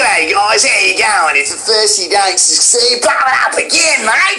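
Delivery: high, over-the-top Aussie caricature; cliched Australian accent. Acted. Mocking what auditions want, exaggerated cliched Australian accent. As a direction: ham up the Australian twang, building to a yell. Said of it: "the most cliched Australian character known to man".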